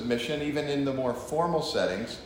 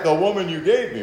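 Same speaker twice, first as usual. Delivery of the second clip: mildly aggrieved husband, a bit higher; husband complaining; 3 semitones higher, 6 dB louder, faster than usual. Acted. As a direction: grumble to the Lord about the wife. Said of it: "we have all said".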